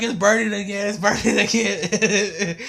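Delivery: high, loud imitation dissolving into laughter; amused retold wail, slowing into a laugh; laughing mock cry. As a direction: mimic pained yelling while cracking up. Acts boy's cries, laughing.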